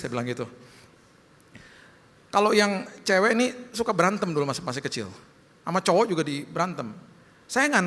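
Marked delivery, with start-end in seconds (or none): none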